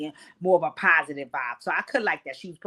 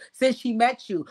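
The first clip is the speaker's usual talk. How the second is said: ordinary delivery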